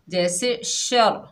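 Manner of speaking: शर said slowly with doubled र; firm tajweed शर; shadda demo. Shown as a demonstration. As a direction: lean on the doubled र in शर. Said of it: "मजबूती से".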